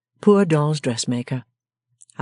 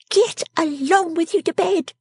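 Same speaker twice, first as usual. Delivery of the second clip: high, cutting scold; snapping; slower than usual. A narrator's reading. Miss Wren sending him to bed, high snapping. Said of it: "snapping him up".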